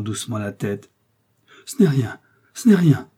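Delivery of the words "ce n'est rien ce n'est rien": raised exclamation, stronger the second time; crying out. A narrator's reading. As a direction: brush it off in a loud cry. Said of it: "s'écria Milady".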